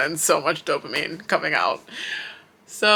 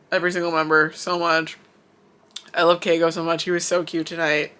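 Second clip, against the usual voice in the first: ordinary talk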